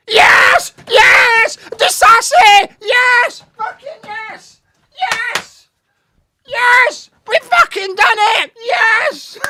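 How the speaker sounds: screaming yes at the top of the voice, very high and loud; ecstatic goal screams. Real, not acted. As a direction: roar the goal like you can't believe it. Goal scored, fan celebrating.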